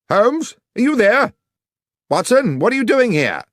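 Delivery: high and loud, calling through a locked door. A narrator's reading. Watson calls through the locked basement door, loud and high.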